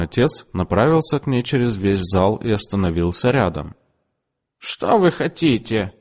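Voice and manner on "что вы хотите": haughty question. A narrator's reading. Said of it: "спросила она".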